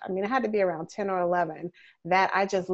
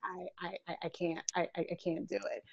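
soft, stammering I-can't; adult giving up meat after movies, soft and stammering; faltering refusal; 4 semitones lower, 9 dB quieter, faster than usual. Retold or imitated. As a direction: trail off, softer, stuttering. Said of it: "I was just like".

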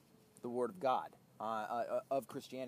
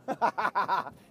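laugh; 5 semitones higher, 10 dB louder than usual. Real, not acted. Laughing at angry driver.

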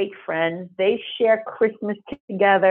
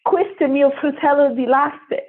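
higher, Italian exclamation; Italian spoken by an emotional old man; Italian man quoted; 7 semitones higher than usual. Retold or imitated. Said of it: "he says".

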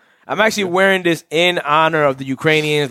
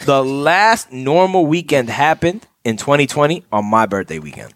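ordinary talk

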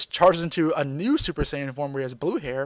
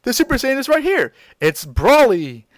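lightly voiced, higher cheerful hero; voiced Goku; 8 semitones higher, 4 dB louder than usual. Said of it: "Goku shows up and says".

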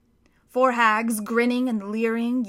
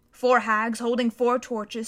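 plain reading voice

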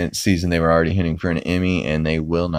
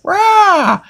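high, loud mock yell; high shrieking yell; mock scream; 6 dB louder than usual. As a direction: scream in sudden terror, hammed-up. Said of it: "Peter screams like".

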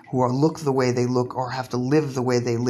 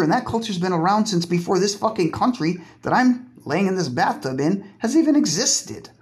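normal talking voice

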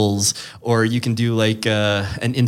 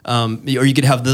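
normal talking voice

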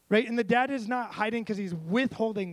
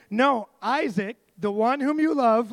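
louder, slow, stressed correction; very clear God; 4 semitones higher, 7 dB louder, slower than usual. Retold or imitated. God naming Isaac to Abraham, slow and emphatic. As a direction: leave no doubt, drag it out loud. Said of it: "he's very clear".

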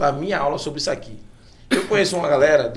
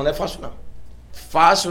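ordinary talk, nothing marked

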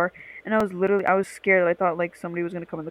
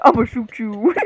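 laughing echo of the flat threat, a bit louder; mocking deadpan threat; 3 semitones higher, 8 dB louder, slower than usual. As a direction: tease how flatly she said I'ma shoot you. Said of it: "the way you said that".

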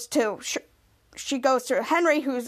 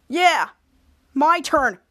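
mild mock-irritated sarcasm; lightly sarcastic, with a touch of anger; angry sarcasm; 4 dB louder, slower than usual. Henry taking his turn in the game, mildly sarcastic. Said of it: "Henry said angrily, sarcastically".

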